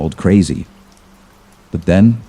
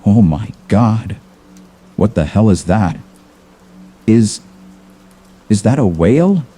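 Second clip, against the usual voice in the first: higher-pitched alarm at normal volume; mild mock scream; 4 semitones higher than usual. A narrator's reading. Sailor reacting to the unknown creature, understated scream. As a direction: act out understated, disbelieving panic. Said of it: "a scream pierced the air".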